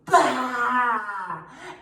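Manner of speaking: loud breathy roar with tongue out; lion's breath roar. Shown as a demonstration. Teacher leads kids in a loud breathy lion roar. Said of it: "Make it your best one yet".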